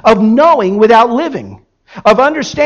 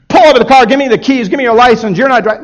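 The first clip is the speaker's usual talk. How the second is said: loud, higher, stern demand; stern rebuke; 4 semitones higher, 5 dB louder, faster than usual. Retold or imitated. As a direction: snap the orders like an angry dad. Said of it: "I'm not gonna rebuke him".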